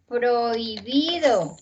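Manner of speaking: "prohibido" very slowly, oi gliding as one syllable; drawn-out diphthong. Shown as a demonstration. Teacher showing students the vowel union, "prohibido" drawn out slowly. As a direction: stretch "prohibido" out slowly, oi kept together. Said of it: "qué pasa en prohibir".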